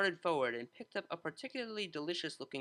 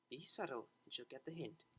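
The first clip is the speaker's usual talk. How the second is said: quiet hint; 2 semitones lower, 11 dB quieter than usual. A narrator's reading. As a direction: drop the hint under the breath. Octavia hinting quietly.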